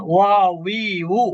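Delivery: "ওয়া, উই, উ" with a w sound, as in English we; letter demonstration. Shown as a demonstration. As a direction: sound out "ওয়া, উই, উ" with a w glide on each. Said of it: "এটাকে আমরা এভাবে যদি বলি". ("ওয়া, উই, উ" ইংরেজি উই-এর মতো উচ্চারণে)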